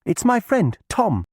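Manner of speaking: put-on high, loud girl's call; young girl voice. A narrator's reading. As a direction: call out eagerly to old cousin. Alice answers the witch.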